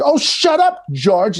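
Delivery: high playful brush-off; mock Claudia. Claudia answering the rude puppet, high teasing brush-off. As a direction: toss it back teasing. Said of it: "and she's like".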